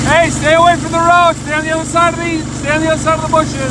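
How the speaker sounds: loud, fast warning call; warning shout. Real, not acted. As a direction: shout a quick warning to stay clear. Warning kids off the road.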